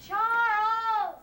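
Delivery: loud high call across the house. Acted. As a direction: shout his name to find him. Mother calling son from elsewhere.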